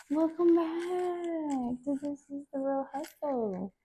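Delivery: soft high host voice. Pretend. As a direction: greet viewers softly and sweetly. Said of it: "I like doing that".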